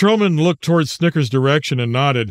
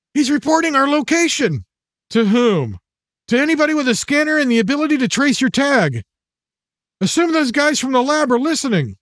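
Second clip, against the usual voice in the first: put-on tense young man voice; 9 semitones higher than usual. Matt voiced as character.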